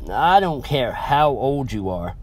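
plain reading voice

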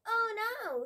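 high-pitched, alarmed "oh no"; mild storybook alarm, high voice; dismayed cry. A narrator's reading. Biff dismayed at stolen key.

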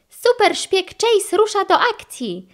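high-pitched heroic announcement; high cry without much added volume; eager cry. A narrator's reading. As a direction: announce it like a little super spy. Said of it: "krzyknął Chase".

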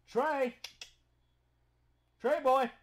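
loud high call across the house; calling the dog. Real, not acted. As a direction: shout for Trey to come.

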